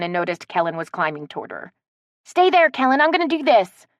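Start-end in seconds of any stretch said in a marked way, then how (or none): 2.2-3.9 loud high yell. A narrator's reading. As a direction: yell down the tree. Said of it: "Stevie yelled".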